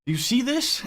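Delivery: high, startled question; alarmed disbelief. Retold or imitated. Tripping guy pleading with buddy, startled high question. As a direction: beg him to see it too. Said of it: "And I'm like".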